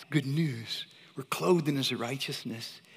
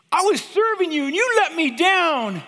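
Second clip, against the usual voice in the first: high, loud accusing cry; angry at God; 13 semitones higher, 11 dB louder than usual. Retold or imitated. Frustrated missionary accusing God, loud angry cry. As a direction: throw the complaint up at God. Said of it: "angry to the point of".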